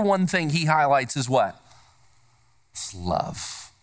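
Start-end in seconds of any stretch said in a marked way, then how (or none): 2.7-3.7 whispered, much softer; hushed reveal. Acted. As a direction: breathe the answer like a secret. Punchline answer dropped to hush.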